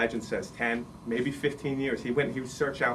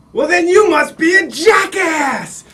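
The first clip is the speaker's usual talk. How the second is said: high, slow, full-force shout; head clown's roaring punchline; 14 dB louder, slower than usual. Acted. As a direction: bellow the insult for the whole tent. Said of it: "He said".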